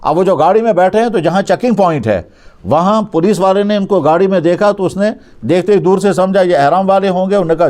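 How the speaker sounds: ordinary talk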